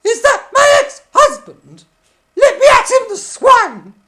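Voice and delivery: very high, very loud shrieking; furious screech. Acted. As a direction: screech like an enraged puppet wife. Judy bursts in furious.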